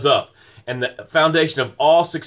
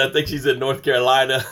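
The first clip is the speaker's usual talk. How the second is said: ordinary delivery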